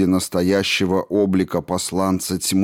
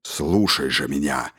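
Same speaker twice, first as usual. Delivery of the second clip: sinister servant voice; 5 semitones lower than usual. A narrator's reading. Dark lord's servant speaking.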